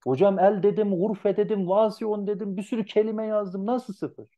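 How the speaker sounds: mock protesting student, high-pitched; whiny student protest. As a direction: whine like a wronged student. Öğrencinin itirazını canlandırıyor. (haksızlığa uğramış öğrenci gibi, yüksek perdeden sızlanarak)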